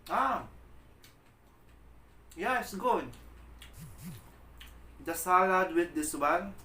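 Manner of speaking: blown away by the taste; excited. Real, not acted. Tasting the skin with salad.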